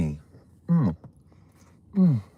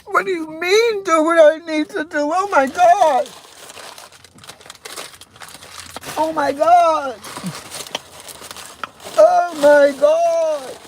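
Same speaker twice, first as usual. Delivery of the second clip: much higher, hammed-up shocked voice; mock dramatic outrage; 13 semitones higher than usual. Vlogger parodying a shocked commenter in a high hammed-up voice. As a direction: play the scandalized diva.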